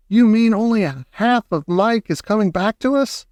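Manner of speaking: crying out in mild horror; horrified cry. A narrator's reading. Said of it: "she cried".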